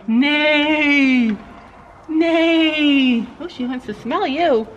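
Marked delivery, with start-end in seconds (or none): 0.1-3.2 put-on drawn-out falling neigh for a baby; horse neigh. Shown as a demonstration. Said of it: "What does a horsey say?".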